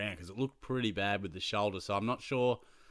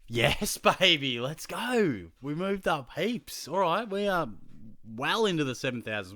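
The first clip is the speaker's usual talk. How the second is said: higher, louder real delight; excited cheer; 6 semitones higher, 5 dB louder than usual. Real, not acted. Vlogger cheering his Supercoach rank jump, excited. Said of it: "celebrates ranking jump".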